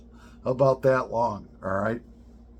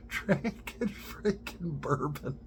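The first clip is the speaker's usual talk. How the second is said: slow, high, breathless words squeezed out through laughter; barely getting it out for laughing; laughing hard; 4 semitones higher, 7 dB quieter, slower than usual. Real, not acted. Picturing his buddy drinking bourbon, laughing too hard. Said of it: "I can't stop laughing so hard".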